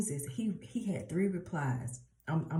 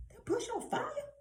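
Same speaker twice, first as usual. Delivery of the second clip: very high, softer squeak of disbelief; incredulous double take; 5 dB quieter than usual. Acted. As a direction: act out startled bafflement in a high voice. Imagined reaction to burning bush.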